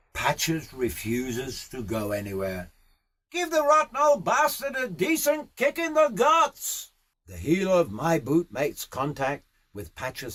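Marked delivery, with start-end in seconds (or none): 3.3-6.9 high, hollered riding instruction; Aussie boss urging on a rider; called-out order. Acted. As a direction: holler it across the paddock.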